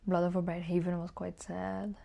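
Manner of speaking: ordinary delivery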